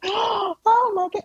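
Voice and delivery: very high, slow, drawn-out gasping oh my God; shocked crowd. Retold or imitated. Studio audience seeing Dwayne arrive, very high shocked wail. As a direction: mimic a scandalized audience. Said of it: "they all turn around and they're like".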